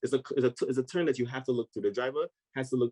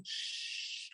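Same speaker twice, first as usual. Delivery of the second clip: long hushed "shhh" of a skid; voiceless sliding whoosh; imitated skid noise; 15 dB quieter than usual. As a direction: make the skid sound, drawn out. Imitates the bike skidding.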